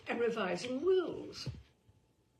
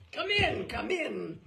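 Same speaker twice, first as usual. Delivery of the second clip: delighted patriarch; 3 semitones higher, 6 dB louder than usual. Acted. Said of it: "He's delighted to see you".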